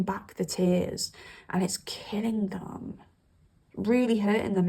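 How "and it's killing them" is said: hushed aside; whispered. Real, not acted. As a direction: drop to a soft whisper. Describing held-back tears.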